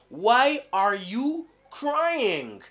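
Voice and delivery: why are you crying drawn out, why stressed; teacher slowly modelling the question; slow model question. Shown as a demonstration. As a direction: stretch it for learners. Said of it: "Ou encore".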